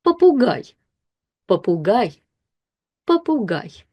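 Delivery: Попугай said papugai, stress on the last syllable; careful Russian reading. Shown as a demonstration. Teacher reading попугай aloud for learners, careful. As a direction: sound out попугай with the O as A. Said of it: "this O turns into A".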